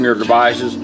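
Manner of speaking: normal talking voice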